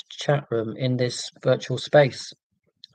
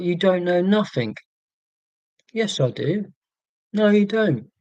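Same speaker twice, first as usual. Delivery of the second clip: mock soap argument; 5 semitones higher than usual. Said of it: "people just argue all the time".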